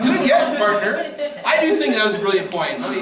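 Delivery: sarcastic, laughing mock praise for a partner; mock gushing partner. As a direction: gush over your partner's point through laughter. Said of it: "You're like".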